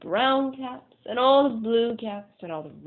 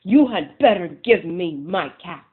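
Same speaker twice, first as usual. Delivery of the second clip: slow, loud, stamping threat; quite angry; 9 dB louder than usual. Acted. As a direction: stamp your foot and warn them. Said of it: "Now he felt quite angry".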